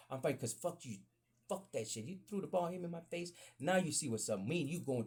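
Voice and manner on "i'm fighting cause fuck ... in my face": ordinary talk, nothing marked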